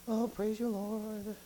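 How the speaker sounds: softly, lazily mumbled praise, no burst in it; subdued praise. Acted. Preacher mimicking half-hearted praise to congregation, subdued. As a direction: give praise with the energy drained out. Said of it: "it doesn't indicate just like".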